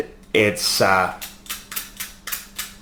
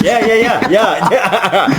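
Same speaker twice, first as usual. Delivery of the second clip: laughing; 7 semitones higher, slower than usual. Real, not acted. Laughing at being teased.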